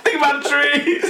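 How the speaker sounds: high hippie plea through laughter; hammed-up eco-protester voice; mock hippie. Teacher seeing five blank pages, mock hippie. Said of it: "I put my bandana on and go".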